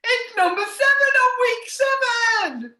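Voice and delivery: high, loud amazed announcement; excited disbelief. Real, not acted. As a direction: exclaim it in astonishment. Surprised by a coincidence.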